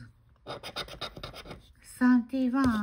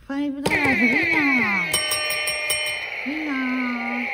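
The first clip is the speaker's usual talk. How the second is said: thrilled shout of a win; excited; 4 semitones higher, 4 dB louder than usual. Real, not acted. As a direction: call out the win with joy. Winning scratch ticket.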